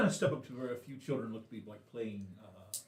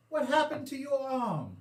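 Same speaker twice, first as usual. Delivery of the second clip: put-on young boy voice; 11 semitones higher, slower than usual.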